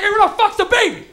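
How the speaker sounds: loud, high outburst; mock freaked-out shout. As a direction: yell it as a baffled bystander. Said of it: "when you don't see the baby".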